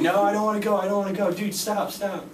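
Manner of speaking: high, whiny pleading, a touch of tears; begging not to be put on the ride; retold crying plea. Scared thirteen-year-old begs friends, high whiny pleading. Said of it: "I was sitting there crying at thirteen".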